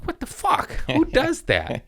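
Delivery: squeaky high disbelief; incredulous mock outburst; retold anger. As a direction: re-enact outrage, voice shooting up. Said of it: "First day was anger".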